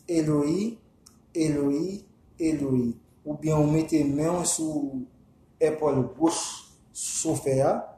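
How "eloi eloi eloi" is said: "Eloi, Eloi, Eloi", first two rising, last falling; recited invocation. Shown as a demonstration. Teacher shows listeners what to say before riding, recited invocation. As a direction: say "Eloi" three times, separated, drawing up the end until the last drops. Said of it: "vous dites".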